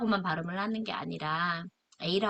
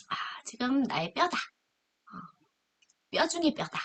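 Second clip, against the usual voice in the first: pitched up, amazed exclamation; excited Adam; 7 semitones higher than usual. Acted. Adam sees the woman, a touch of amazed delight. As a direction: gasp it out in delighted wonder. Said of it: "이 여자는".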